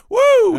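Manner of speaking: high, loud "woo"; sudden high-pitched whoop; excited whoop. Real, not acted. Mock thrill at researched killer.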